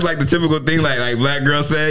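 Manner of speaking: ordinary talk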